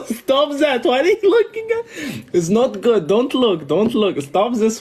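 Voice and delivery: laughing protest. Real, not acted. Laughing at her flirt.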